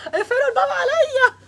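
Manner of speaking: high laughing plea to shut the door. Real, not acted.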